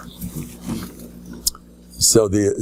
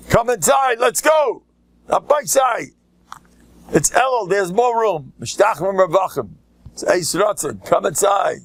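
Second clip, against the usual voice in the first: high beckoning call to latecomers; calling people in; 9 semitones higher than usual. Retold or imitated. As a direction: wave them inside.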